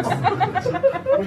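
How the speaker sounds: very high, slow squeal; cartoonish drawn-out squeak; squeaky comic voice. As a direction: stretch it into a silly squeal.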